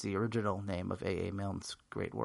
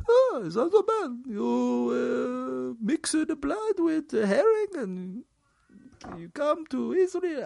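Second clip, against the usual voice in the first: cartoonish Israeli accent, pitched way up and drawn out; mock Israeli accent; 13 semitones higher, 6 dB louder, slower than usual. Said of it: "working on my offensive Israeli accent".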